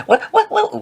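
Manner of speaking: very high squeaky babble; mock a startled, high-pitched fast talker; motor-mouthed woman. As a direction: squeal it in a tiny voice. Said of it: "she was like".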